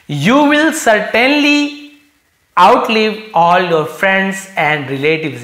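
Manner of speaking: will pitched high, slow and loud; emphatic slow reading. Shown as a demonstration. Teacher reading the fortune teller's prophecy, stressing will. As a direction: lean hard on will, then slow down.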